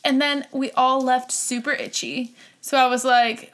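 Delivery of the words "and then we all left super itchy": ordinary delivery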